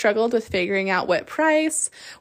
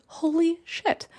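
stunned surprise; 8 semitones higher, slower than usual. Real, not acted. Sees the pre-sale code.

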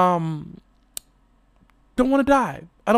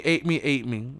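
ordinary delivery

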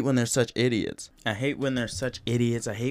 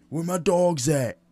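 mock hype call; 7 semitones higher, 4 dB louder than usual. Quoting the rapper's famous call.

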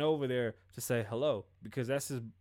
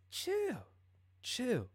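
mocking chill; 9 semitones higher, 4 dB quieter than usual. Real, not acted. Telling Logan to calm down.

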